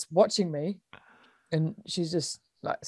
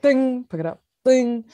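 high, ringing "ding"; imitated ball ding; 6 semitones higher, 6 dB louder, slower than usual. Player mimicking her passes at the pole, high dings. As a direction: sing out each ding, higher than talk.

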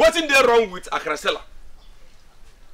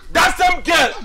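high, raised voice; emphatic, pitched up; heated insistence; 7 semitones higher, 4 dB louder, much slower than usual. Acted. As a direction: insist loudly in her defence. Defending girl who saved him.

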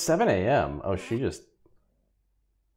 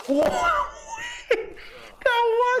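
much higher, startled and laughing; surprised whoa; slower than usual. Real, not acted. Player startled by the Sim, high and laughing. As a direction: yelp it in surprise. Sim suddenly does something odd.

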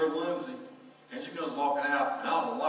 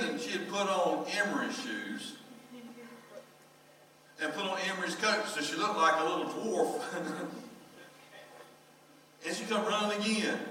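usual speaking voice

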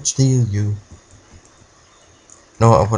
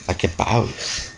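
slow, softer, dragged-out like a boss; 6 dB quieter, much slower than usual. Acted. Gamer gloating after levelling up, in a slow, dragged-out cool-guy voice. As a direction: savour each word like a movie badass. Repeated slow low take.

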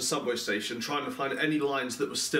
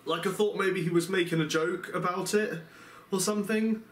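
usual speaking voice

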